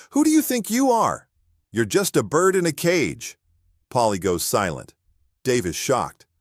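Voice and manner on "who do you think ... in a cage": high, pitched-up shout at the parrot; shouting back. Acted. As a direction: shout the bird down, mimicking it. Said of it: "and shouts".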